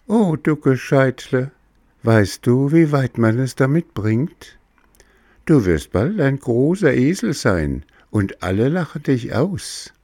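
high, scolding little cricket; warning cricket voice. Acted. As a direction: wag a finger as the cricket. Cricket warns.

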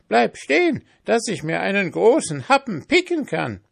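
high, loud crowing rooster. A narrator's reading. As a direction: call out like a rooster. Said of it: "rief der Pfannkuchen".

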